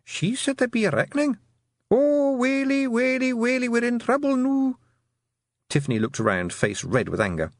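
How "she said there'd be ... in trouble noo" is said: very high tiny creature voice; small voice. A narrator's reading. Tiny creature frets to another about the reckoning, small wailing voice. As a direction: wail in a tiny, high-pitched voice. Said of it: "the sound of small voices".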